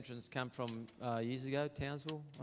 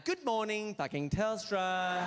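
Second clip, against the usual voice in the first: mock call centre greeting; 7 semitones higher, 4 dB louder, slower than usual. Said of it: "Imagine that was their name".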